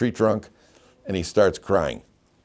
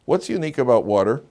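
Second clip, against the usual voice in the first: ordinary talk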